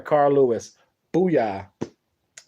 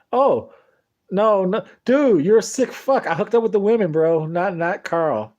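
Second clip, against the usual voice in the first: higher and louder, teasing buddy; mocking friend; 5 semitones higher, 3 dB louder than usual. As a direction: rib him loudly like a rowdy friend. Friend mocking him on the ride home.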